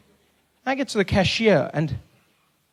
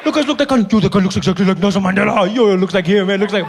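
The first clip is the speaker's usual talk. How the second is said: excited driver; 4 dB louder than usual. Retold or imitated. Driver spotting Mandela on the road, telling friends excitedly. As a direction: point him out to your mates, all worked up. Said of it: "I'd be like".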